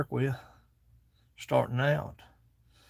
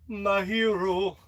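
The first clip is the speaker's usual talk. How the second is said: slow tender repeat; 5 semitones higher, much slower than usual. Pretend. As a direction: let "My hero." linger, slow and fond. Repeats it slowly.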